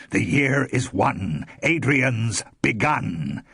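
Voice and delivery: slow, loud, chanted declaration; slow, loud ritual chant; triumphant proclamation. A narrator's reading. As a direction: proclaim it like a prophecy.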